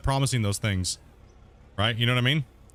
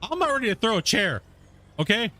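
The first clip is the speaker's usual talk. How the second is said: higher, joking threat to throw a chair; mock heated; 7 semitones higher than usual. Real, not acted. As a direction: act riled up but half laughing. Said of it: "I'm getting all heated now".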